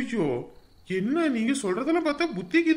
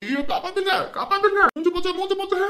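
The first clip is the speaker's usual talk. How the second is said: high, loud cries for help; panicked screaming; 8 semitones higher, 6 dB louder than usual. Acted. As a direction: scream for help as your face burns. Burned man screaming for help. (முகம் எரிந்து அலறும் குரல்)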